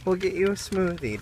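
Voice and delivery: ordinary talk, nothing marked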